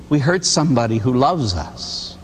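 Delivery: ordinary talk, nothing marked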